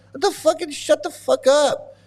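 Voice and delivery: high, exasperated snap to shut up; angry outburst. Real, not acted. Fed up with the caller, snapping at him to shut up.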